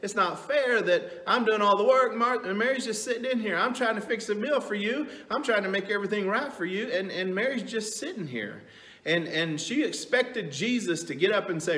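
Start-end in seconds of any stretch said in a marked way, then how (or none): 0.0-8.6 whining. Retold or imitated. Martha whining to Jesus that Mary won't help, high whine. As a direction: whine it like a put-upon sister. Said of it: "she kinda whined to Jesus".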